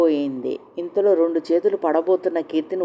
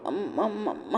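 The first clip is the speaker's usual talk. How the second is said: soft hurt groan; hurt whimpering moan; pained moan; 2 semitones higher, 9 dB quieter than usual. Acted. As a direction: moan in pain, quietly. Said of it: "అని మూలుగుతోంది కీర్తి".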